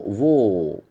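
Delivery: War with the letter A said as an O; O sound in war. Shown as a demonstration. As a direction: say War with an O vowel. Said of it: "the sound is O".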